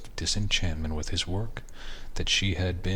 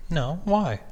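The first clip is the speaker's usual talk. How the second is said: higher, curious wife; 11 semitones higher than usual. Acted. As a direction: ask it as the wife. Wife voiced in a story.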